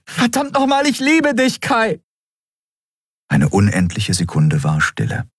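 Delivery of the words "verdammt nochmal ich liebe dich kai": desperate outburst. A narrator's reading. Love confession outburst.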